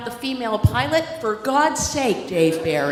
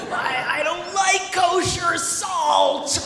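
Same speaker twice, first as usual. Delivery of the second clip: Medusa head; 7 semitones higher, much slower than usual. Retold or imitated. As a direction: drag it out like a raging Medusa. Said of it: "the Medusa head comes down".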